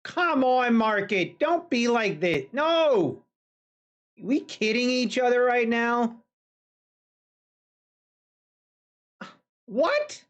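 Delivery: begging the chart to turn around, voice climbing; exasperated pleading. Real, not acted. Pleading with falling market.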